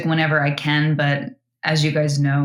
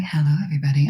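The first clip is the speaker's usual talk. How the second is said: ASMR whisper; 2 semitones lower, much faster than usual. Retold or imitated. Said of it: "an amazing A S M R voice".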